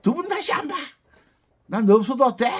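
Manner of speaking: high-pitched mock breakup outburst; mock lovers' quarrel. Accordion teacher mimicking couples who always fight, mock-huffy. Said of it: "둘이 좋아하면 맨날 싸우잖아요".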